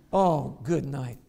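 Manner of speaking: ordinary delivery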